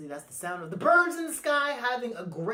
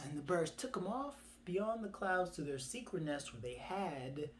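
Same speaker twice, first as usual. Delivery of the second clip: ordinary delivery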